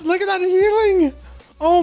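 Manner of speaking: very high, drawn-out exclamation; amazed outburst. Real, not acted. Streamer to chat at huge healing numbers, amazed. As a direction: gape at it in real astonishment.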